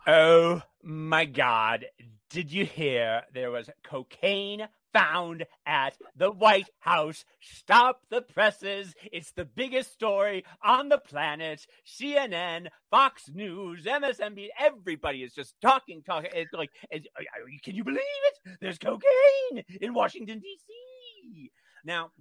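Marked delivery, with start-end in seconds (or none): 0.1-11.7 loud, slow fake astonishment; hammed-up scandalized gasp; mock shock. 17.2-21.5 a much higher, breaking fake cry; sarcastic wail of shock; mock disbelief. Mock disbelief rising to fake sob.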